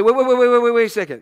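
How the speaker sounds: loud rushed pleading waits; panicked pleading. Pretend. Victim stopping a mob thug, loud panicked waits. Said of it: "you say".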